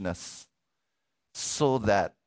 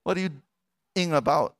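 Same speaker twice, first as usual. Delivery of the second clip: puzzled onlookers; 7 semitones higher than usual. Retold or imitated. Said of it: "everybody's wondering".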